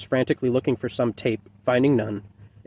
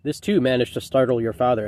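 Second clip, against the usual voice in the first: ordinary delivery, nothing marked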